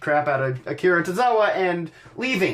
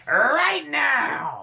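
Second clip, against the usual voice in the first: a hint of an announcer boss, slow; lightly voiced Stephanie McMahon; Stephanie McMahon impression; 8 semitones higher than usual. Shown as a demonstration. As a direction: stretch it out like an authority figure announcing a match. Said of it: "That's my Stephanie McMahon".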